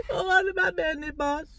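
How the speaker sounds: put-on high, loud comic Scottish accent; mock Scottish accent. Imagined Scottish viewer reacting to her, mock Scottish accent. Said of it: "talking bloody Scottish".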